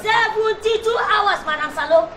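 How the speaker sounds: SEVENTY-TWO HOURS loud, high and drawn out; emphatic repetition. Acted. Warning Madam Salo how fast it happens, emphatic repetition.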